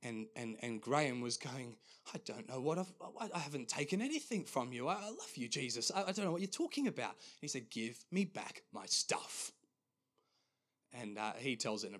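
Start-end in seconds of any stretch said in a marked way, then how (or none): 2.1-7.1 high, stammering, hurried denial; flustered protest. Retold or imitated. Dreamer protesting his love to Jesus, high and flustered. 7.7-9.5 very slow, measured demand; firm and serious. Retold or imitated. Jesus speaking to the dreamer, slow, firm and serious. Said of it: "quite firm and quite serious".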